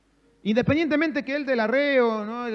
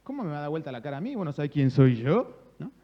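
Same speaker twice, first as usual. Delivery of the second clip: mock indignation. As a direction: pretend affronted retort, building to a threat. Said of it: "Yo pudiera decir". (indignación fingida, con tono de amenaza)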